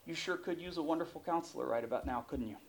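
hushed, quick, confiding aside; soft Jesus quote. Acted. Jesus looking at you, saying.

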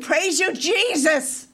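high, fervent retold praise; exclaimed praise. Believer praising Jesus right after a healing, exultant. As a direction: cry out thanks to Jesus.

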